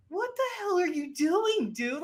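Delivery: high-pitched, exasperated disbelief; incredulous. Retold or imitated. Viewer scolding the actor for yelling while sneaking, incredulous. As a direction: question him in a high, baffled voice.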